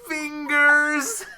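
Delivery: high, drawn-out strained cry of pain, half laughing; pained squeal. Retold or imitated. Retelling a coworker crying out as his fingers get squeezed, high pained squeal. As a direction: squeak it out like your hand's being crushed. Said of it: "I just hear Anthony go".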